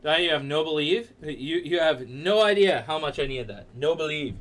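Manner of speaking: ordinary talk, nothing marked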